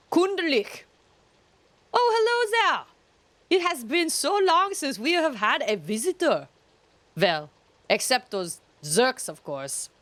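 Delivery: put-on high, loud German-accented character greeting; sing-song, high-pitched put-on German accent; mock German accent. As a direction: greet a rare visitor in a hammed-up German accent. Reads game character in put-on accent.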